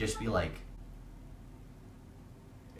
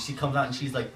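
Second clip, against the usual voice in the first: usual speaking voice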